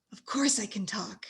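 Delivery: whispered ghoul reply. Acted. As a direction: breathe it out in a whisper. Ghoul replies.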